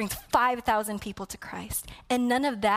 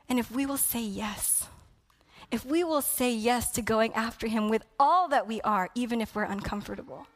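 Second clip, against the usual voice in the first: ordinary talk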